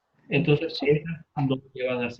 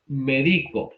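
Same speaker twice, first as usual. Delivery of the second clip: "Medico" said me-DI-co, DI stressed; stress on the middle syllable; much slower than usual. Shown as a demonstration. Teacher repeating the word for students, stress on DI in "Medico". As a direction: put the weight on DI in "Medico". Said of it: "Es una palabra llana".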